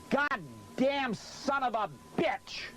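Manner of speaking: real rage at the light; furious curse. Real, not acted. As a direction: drag out every word in fury. Blinded by the hot light.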